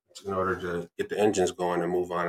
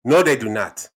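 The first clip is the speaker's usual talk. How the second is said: put-on loud African-accented denial; 5 dB louder than usual. Dad rebuts the objection, African accent. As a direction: contradict firmly in an African accent. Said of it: "African accent".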